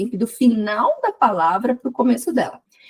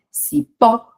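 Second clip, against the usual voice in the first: "cipó" with a punched, louder final PÓ; last syllable stressed; 3 semitones higher, 5 dB louder than usual. Shown as a demonstration. Teacher showing oxytone words, final PÓ of "cipó" stressed. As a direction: hit the PÓ of "cipó". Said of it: "quando é a última mais forte".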